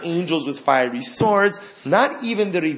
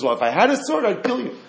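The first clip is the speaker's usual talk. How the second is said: higher, breezy, like chatting with a friend; casual offhand reply; 8 semitones higher than usual. Retold or imitated. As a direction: toss it off as if nothing's strange. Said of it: "like you would respond to your friend".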